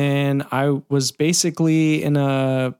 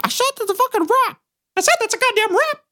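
much higher cartoon squeak, getting more insistent; Mickey Mouse yelling. Mickey Mouse ending the session, high squeaky and insistent. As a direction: insist on wrapping in a shrieking cartoon pitch. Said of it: "Mickey Mouse".

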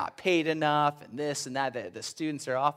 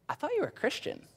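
a light imitated gasp of disbelief, much higher; mock disbelief; 13 semitones higher, 4 dB quieter, faster than usual. Coworkers catching a believer slacking, mock disbelief. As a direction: sound let down and shocked. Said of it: "they're gonna look at you and say".

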